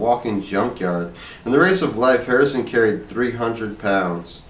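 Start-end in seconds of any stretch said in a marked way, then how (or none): none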